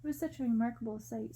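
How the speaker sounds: ordinary delivery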